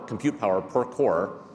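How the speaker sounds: normal talking voice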